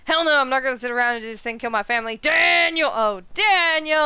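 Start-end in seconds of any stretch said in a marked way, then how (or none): 2.2-4.0 loud yell of Daniel, falling off; shouted name. Acted. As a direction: call his name out across the room. Character calling out a name.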